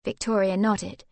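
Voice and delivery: usual speaking voice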